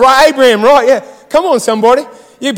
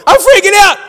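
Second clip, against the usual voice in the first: mock panic, high-pitched yell; freaking out; 8 semitones higher, 4 dB louder than usual. Believer panicking over God's call, high mock freak-out. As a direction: yell it in exaggerated alarm. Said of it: "we freak out when we don't need to".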